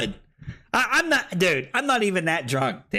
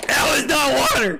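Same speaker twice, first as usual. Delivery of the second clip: outraged; 7 semitones higher, 4 dB louder than usual. Real, not acted. Protesting the strong drink.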